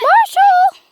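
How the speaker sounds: high shout for the cat; calling out. Real, not acted. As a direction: call his name across the yard.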